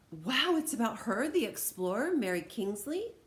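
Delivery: loud, high, thrilled wow; amazed. Acted. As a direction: gasp it out in delight. Child amazed by the book.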